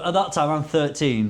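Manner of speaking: normal talking voice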